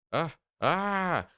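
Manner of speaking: high startled yelps of horror; horrified cry. Acted. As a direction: recoil with a sharp high cry. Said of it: "recoiled in horror".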